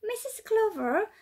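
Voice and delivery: put-on a child's worried plea, a touch of dismay. Little Molly telling her dance teacher, mild dismay. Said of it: "said Molly in dismay".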